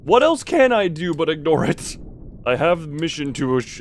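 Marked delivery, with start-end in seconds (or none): 0.0-2.0 louder, exasperated outburst. Real, not acted. Player frustrated with the shaking sub, raised exasperated voice. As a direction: throw up your hands in frustration.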